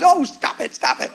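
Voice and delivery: high, playful stop it; mock protest. Husband teasing wife over triple hugs, high mock protest. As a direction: pretend to fend off the love. Teasing about so much love.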